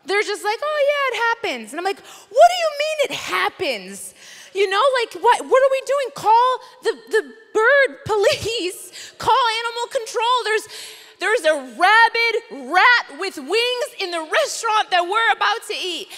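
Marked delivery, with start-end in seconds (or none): 0.6-1.7 shrugging worker. Retold or imitated. Unbothered restaurant workers shrugging it off. 2.0-16.1 high, loud, indignant rant; loud high outrage, retold for laughs; outraged disbelief. Outraged retelling of her reaction.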